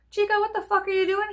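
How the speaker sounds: a hint of a sassy mom hollering; mom yelling at dog. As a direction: yell at the stray dog in the yard. Mom scolding stray dog.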